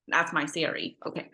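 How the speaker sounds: normal talking voice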